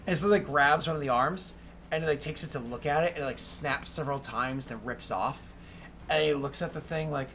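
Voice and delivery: ordinary talk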